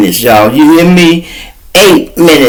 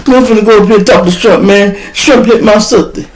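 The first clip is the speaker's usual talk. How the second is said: rushed angry brother; 7 semitones higher than usual. Retold or imitated.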